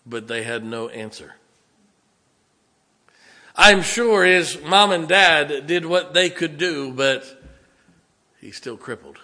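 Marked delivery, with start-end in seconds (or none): 3.5-7.2 loud, slow, pitched-up preaching cry; full-force pulpit appeal, much louder and slower; impassioned preaching. Acted. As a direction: lean in and preach it out slowly, loud and high. Preacher building to emotional peak.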